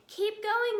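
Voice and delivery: high loud breathless call; panting urging. A narrator's reading. Mister Fox urging his children on while fleeing. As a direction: push them on, out of breath. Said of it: "panted Mister Fox".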